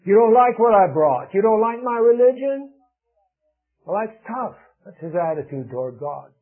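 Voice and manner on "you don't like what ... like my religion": louder, higher indignant retort; a preacher acting out an indignant Cain; mock angry Cain. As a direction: voice Cain's defiant complaint. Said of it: "Cain is angry".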